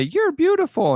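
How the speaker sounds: very high squeaky toy voice. Retold or imitated. A Furby toy coos at its owner, very high and squeaky. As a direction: chirp it like a tiny talking toy. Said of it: "and they'd say".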